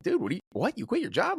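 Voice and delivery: high, incredulous what; retold disbelief. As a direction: pitch jumping high in mock shock. Retold shock at friend quitting.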